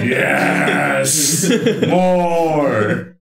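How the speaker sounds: loud, hammed-up ecstatic battle cry; ecstatic weapon. Game master voices a delighted weapon to its owner, ecstatic. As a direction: bellow it like a weapon thrilled by battle. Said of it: "Whelm is ecstatic right now".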